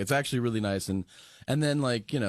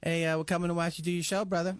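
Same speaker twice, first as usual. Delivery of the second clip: Mike Tyson impression; 6 semitones higher, faster than usual. Retelling Mike Tyson phone call.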